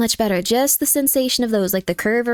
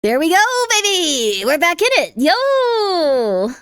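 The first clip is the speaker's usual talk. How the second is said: hyped cheer; 6 semitones higher, much slower than usual. Real, not acted. Streamer cheering to chat as play resumes, hyped yell. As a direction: hype chat up as the game restarts.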